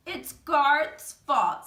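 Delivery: loud, slow, pointed accusation; indignant blame. A narrator's reading. Schoolgirl blaming a classmate, loud and indignant. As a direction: tattle on him loudly so the teacher hears.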